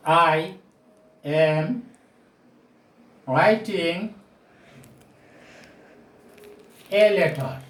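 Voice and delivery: spaced out word by word with long pauses; teacher dictating a sentence for students to copy; slow dictation. Shown as a demonstration. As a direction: dictate it slowly to the class, one word at a time. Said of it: "Now I am giving you another example".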